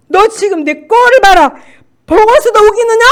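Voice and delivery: high, loud, jeering challenge; devil shouting back. Retold or imitated. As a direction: bellow the devil's taunt. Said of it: "마귀도 더 덩달아서 나오고".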